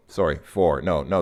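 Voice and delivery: ordinary talk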